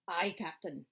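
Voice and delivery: short Scottish "Aye", lightly voiced; old Scots engineer answering the captain; Scottish accent. Engineer replies to his captain, a hint of Scottish accent. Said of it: "there's a Scottish guy in here".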